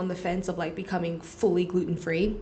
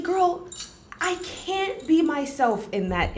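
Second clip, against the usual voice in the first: mock exclaim; 8 semitones higher, slower than usual. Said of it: "and I was like".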